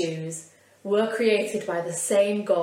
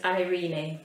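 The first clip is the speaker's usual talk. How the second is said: a quick, unplanned Yorkshire "eirene"; Yorkshire accent. Real, not acted. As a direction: say "eirene" with broad Yorkshire vowels. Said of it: "I said that in a really Yorkshire accent".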